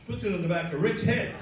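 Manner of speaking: usual speaking voice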